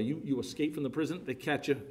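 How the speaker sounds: usual speaking voice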